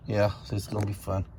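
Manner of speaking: low, deadpan sarcasm; sarcastic. Real, not acted. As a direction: say it dryly, dreading the job. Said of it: "insert sarcasm again".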